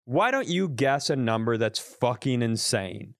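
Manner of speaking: usual speaking voice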